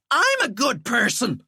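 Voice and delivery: high screeched protest; screeching. Acted. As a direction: screech it defensively. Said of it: "Walter screeched".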